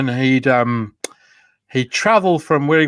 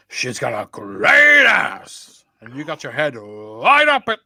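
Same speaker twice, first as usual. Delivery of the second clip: slow, shouted Pacino rasp ending in a bark; Rob Brydon's Al Pacino; slower than usual. Retold or imitated. Impression for co-host, slow shouted Pacino. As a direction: ham up Pacino, big and slow. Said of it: "Rob Brydon doing Al Pacino".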